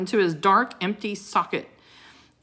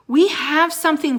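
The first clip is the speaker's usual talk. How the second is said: loud, raised call across the hall; shout; 9 semitones higher, 7 dB louder than usual. Acted. Teddy shouting to distract the wizard. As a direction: shout to get the wizard's attention. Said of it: "Teddy shouted at the wizard".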